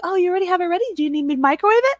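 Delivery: put-on a helpful kid offering to cook, bright and quick; eager son. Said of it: "He's like".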